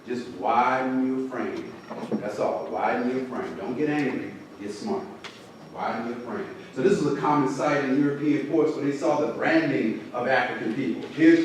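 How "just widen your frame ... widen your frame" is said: low, soft, measured advice; quiet, reassuring mentor tone; calm counsel. Retold or imitated. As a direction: gently settle a student down. Calming the student after challenging her.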